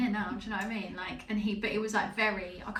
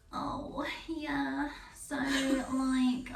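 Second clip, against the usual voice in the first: higher, breathy-put-on flirty voice, lightly done; 4 semitones higher, much slower than usual.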